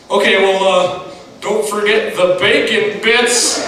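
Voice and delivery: loud, high, drawn-out goofy jab; imitated friend milking his punchline; teasing buddy. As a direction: stretch out the joke, delighted with yourself. Buddy teasing again, acted.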